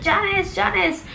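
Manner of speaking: mock frantic calling out; retold calling out. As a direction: call her name high, searching the house. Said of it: "He was screaming out her name".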